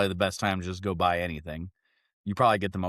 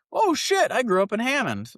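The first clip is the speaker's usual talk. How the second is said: surprised; 4 dB louder than usual. Retold or imitated. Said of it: "And he goes".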